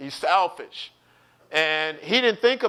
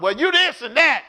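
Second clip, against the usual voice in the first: raised, loud mock tirade; acted angry retort; 7 semitones higher, 6 dB louder than usual. Angry person saying something they shouldn't, mock tirade. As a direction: lash out in a pretend quarrel. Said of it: "You're angry".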